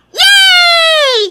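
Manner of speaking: loud, high drawn-out "yay"; delighted child's cheer. Acted. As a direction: squeal it out with glee. Child cheers at promised outing. (masayang sigaw ng bata)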